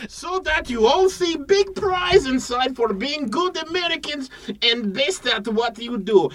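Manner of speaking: hammed-up foreign pitchman selling a box; broken-English pitch for a mystery box; mock foreign accent.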